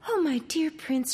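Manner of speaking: high, relieved cry to her prince; glad cry. Acted. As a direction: call out to him with joy. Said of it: "cried Melisande".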